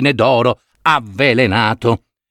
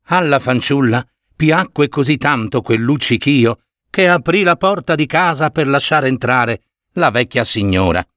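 ordinary delivery, nothing marked

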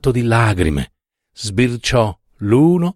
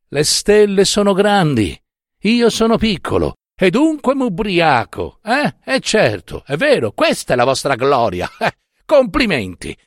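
high, sarcastic parroting; mocking indignation; 8 semitones higher than usual. Lamella mocking the professor's excuse for drinking, sarcastic. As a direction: throw the drunkard's logic back with scorn. Lamella mocks the drunkard's reasoning.

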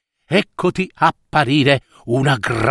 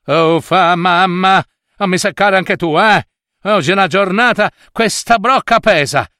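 higher-pitched, huffy whining at mother; bratty complaint; 5 semitones higher than usual. Acted. Naughty Cecilia back with the heavy jug, huffy. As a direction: grumble like a spoilt girl. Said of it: "rispose la fanciulla monella".

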